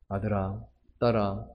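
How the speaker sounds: put-on soft, low tender call to a child; a parent quietly calling son and daughter; gentle parent. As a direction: call your child softly.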